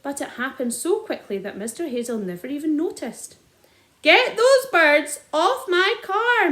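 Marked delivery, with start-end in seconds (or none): 4.0-6.5 high, loud, slow bellow. A narrator's reading. Landowner bellowing, slow and loud. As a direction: roar each word. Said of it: "Mister Hazel bellowed".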